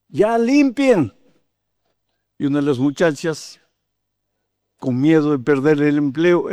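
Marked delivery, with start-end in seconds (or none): 0.1-1.1 orden insistente, aguda y lenta; orden impaciente. Retold or imitated. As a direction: imita al gerente que pierde la paciencia, alargando las palabras. Gerente insiste tras la negativa. (orden impaciente e insistente)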